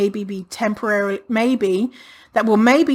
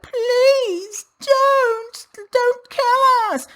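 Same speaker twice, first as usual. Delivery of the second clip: high, slow hammed-up begging; mock pleading; much slower than usual. Imagined thin people begging the monster in a high mock plea. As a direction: whimper like a terrified victim, pitched up. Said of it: "the sad thin people are just like".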